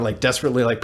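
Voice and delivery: ordinary talk, nothing marked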